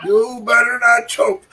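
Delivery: slow, high mocking taunt; mock taunting lady. Heckling lady from last match taunting him, slow high mock shout. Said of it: "I can see this lady telling me".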